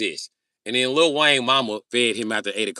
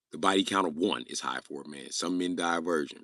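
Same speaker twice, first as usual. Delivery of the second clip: ordinary delivery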